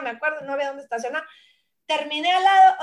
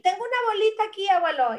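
put-on high child's voice to grandpa; a child telling grandpa about a lump; little girl; 7 semitones higher than usual. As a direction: pitch up like a small girl showing a bump. Voicing herself as child.

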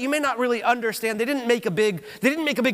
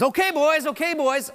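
high-pitched mock cowboy call; bull rider calling out; 6 semitones higher than usual. Preacher plays rider at the gate, high mock call. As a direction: call it up high as if ready in the chute.

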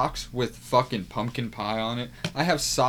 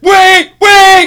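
high loud panicked yell; panicked shout; 23 dB louder than usual. Retold or imitated. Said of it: "mid-ride she'll be like".

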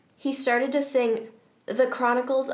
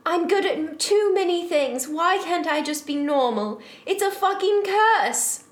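high, theatrical cry of frustration; shouted outburst; 7 semitones higher, 4 dB louder than usual. Acted. Heroine cursing her gifts before running away, hammed-up shout. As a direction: wail it melodramatically, peaking on the last phrase. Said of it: "I shouted".

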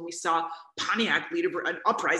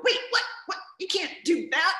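high, raised-voice mock outrage, a touch louder; mock indignant surprise; 11 semitones higher, 3 dB louder than usual. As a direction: play the stunned, protesting colonists. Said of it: "the colonists are gonna go".

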